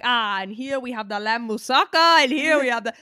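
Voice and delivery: imitating old Greek ladies showing their food; proud Greek cook. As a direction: present the moussaka like a proud Greek auntie. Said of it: "they're like".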